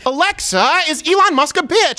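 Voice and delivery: high, insistent caricature; mock Sean King. Mock activist repeating himself to the smart speaker, high put-on voice. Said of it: "It's me, Sean King".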